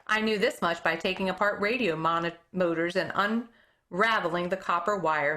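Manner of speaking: plain reading voice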